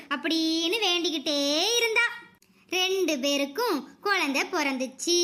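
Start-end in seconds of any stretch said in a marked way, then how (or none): none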